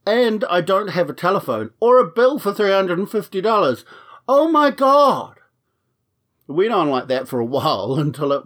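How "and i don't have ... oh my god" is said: exasperated outburst. Retold or imitated. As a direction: act out losing it with the bank. Re-enacted exasperated argument with bank.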